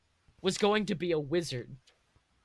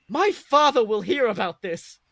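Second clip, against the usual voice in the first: higher, much louder spoiled whine; pouting; 5 semitones higher, 10 dB louder than usual. Acted. Draco pouting at the boy who insulted him, loud and whiny. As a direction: whine it like a sulking rich kid. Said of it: "as he pouted".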